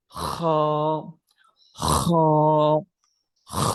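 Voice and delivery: "খ" scraped from the top of the throat; long Arabic khaa on "খ", forced friction first; rasped Arabic "খ". Shown as a demonstration. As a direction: rasp "খ" like clearing phlegm, then hold it. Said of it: "সেখান থেকে এটা মূল উচ্চারণ হয়".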